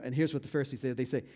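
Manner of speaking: ordinary talk, nothing marked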